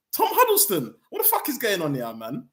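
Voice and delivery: high incredulous outburst. Real, not acted. Fan reacting to the Huddleston hire, incredulous. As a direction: cannot believe the name he just read.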